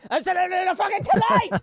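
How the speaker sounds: high, loud comic screech; squealed at full tilt for a laugh; mock screamed emphasis. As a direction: blow the line up into an over-the-top shriek. Mock-intense repeat of his own line.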